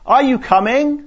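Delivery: loud, high call down the hall; father calling his daughters to leave. Retold or imitated. Father calling out to his daughters, loud and high. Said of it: "call out to his daughters".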